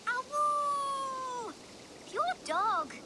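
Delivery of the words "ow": a child's high mock howl; short playful howl; imitated wolf howl. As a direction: howl like a wolf to prove a point. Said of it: "Listen to that sound".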